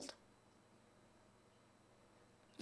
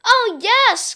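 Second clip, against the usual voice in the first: much higher and louder cheer; excited cry; 12 semitones higher, 12 dB louder than usual. Acted. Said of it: "cried the kids".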